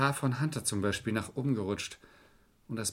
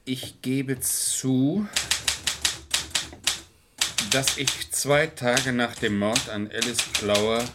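slow, word-by-word dictating pace; slow dictation; slower than usual. Acted. Dictating a letter slowly to Anne at the typewriter. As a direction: spell it out slowly for the typist. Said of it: "Nicht so schnell".

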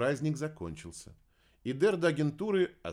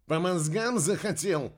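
loud, higher threatening bark; angry bark; 8 semitones higher, 7 dB louder than usual. A narrator's reading. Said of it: "рявкнул Кайл". (громкий угрожающий рык)

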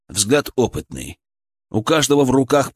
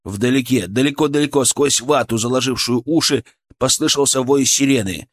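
usual speaking voice

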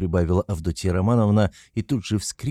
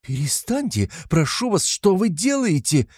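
alarmed; 7 semitones higher than usual. A narrator's reading. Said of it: "вскричала встревоженная до крайности".

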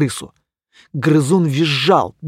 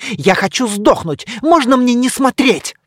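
terrified pleading; 8 semitones higher, 4 dB louder than usual. A narrator's reading. Cheese begs not to watch.

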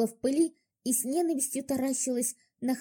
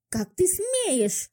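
high, slow outraged shriek; 10 semitones higher, much slower than usual. Acted. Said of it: "взвизгнула девчонка". (визгливо, возмущённо)

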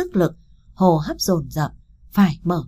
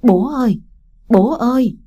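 higher, louder grieving cry; choked-up call; 5 semitones higher, 5 dB louder than usual. A narrator's reading. Child calls to the dead father lying in bed, choked up. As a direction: call out twice, choked with grief. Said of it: "nghẹn ngào gọi hai tiếng".

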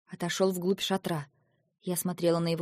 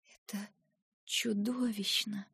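soft, slow, breathless horror; whispered horror; 9 dB quieter, slower than usual. Acted. Eva horrified by Lentar's answer, hushed whisper. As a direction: breathe the words out, appalled. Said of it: "прошептала я".

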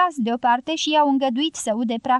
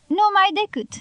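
shouted reply; 8 semitones higher than usual. Acted. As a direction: call it out to the crowd, pitched up high. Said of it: "a strigat Pablo".

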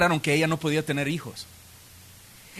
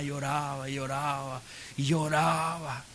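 softer, lower, drawn-out repetition; mournful retelling; 5 semitones lower, 9 dB quieter than usual. Retold or imitated. Preacher pitying poor Ana's endless weeping, mournful and drawn out. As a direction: let each repeat sink and stretch.

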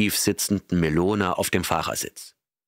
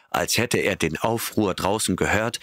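plain reading voice

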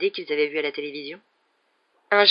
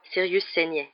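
usual speaking voice

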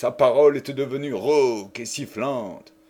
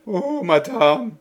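higher, slow, stammered plea; stammering; 9 semitones higher, 3 dB louder, slower than usual. Acted. Marie stammers to Jeanne in distress, higher and slow. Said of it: "balbutia celle-ci".

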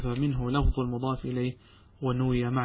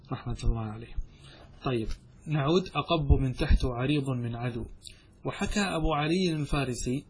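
ordinary talk

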